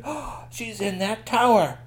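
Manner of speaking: high, slow storybook discovery; Cute Bones suddenly realizing; gasped realization. Acted. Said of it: "and Cute Bones said".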